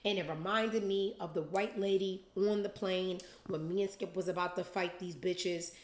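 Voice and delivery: ordinary talk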